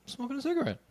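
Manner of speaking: put-on high, soft, careless little-boy reply; nonchalant kid. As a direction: toss it off like it's nothing. His own casual reply as child.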